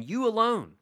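high, ringing cry of praise; fervent exclamation. Acted. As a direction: soar up on alone. Climax of paraphrased prayer.

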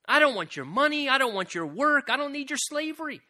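high, loud refusal of money and work; insistent God voice. Acted. God voiced by preacher, rejecting offerings in a high loud cry. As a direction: push each "I don't want" up and loud.

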